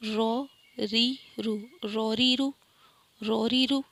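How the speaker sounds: رو heavy, ری thin and light. Shown as a demonstration. Teacher has students listen to heavy and light رو. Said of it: "دیکھیں میرا سن، آپ سنیں اور سمجھیں".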